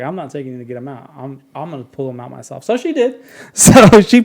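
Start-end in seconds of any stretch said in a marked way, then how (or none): 0.0-2.6 much softer, fast, fed-up mutter; irritated mom. Retold or imitated. Irritated mother tells her son she'll pull the stitches, fast and soft. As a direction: rattle it off, annoyed, under her breath.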